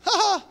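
high, loud scoffing "ha ha"; hammed-up laugh of disbelief; mock laugh. Preacher acting Sarah laughing at God's promise, loud mock laugh. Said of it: "looked and laughed".

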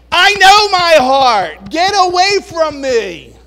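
very loud, high, slow defiant cry; bold declaration. Retold or imitated. Listener standing firm against others, loud defiant cry. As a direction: declare it boldly.

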